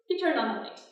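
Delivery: usual speaking voice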